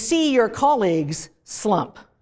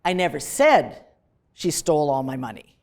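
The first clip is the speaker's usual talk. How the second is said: "said" jumps up, pause, then "she stole all my money" drops low; stress on SAID; 5 semitones lower than usual. Shown as a demonstration. Lecturer showing a different meaning, stress on SAID. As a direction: hit "said" and pause after it. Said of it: "Depending on which word you emphasize".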